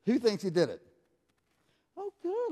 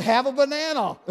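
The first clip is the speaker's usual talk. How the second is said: put-on mishearing old man; 6 semitones higher, 6 dB louder than usual. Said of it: "That's my old ears".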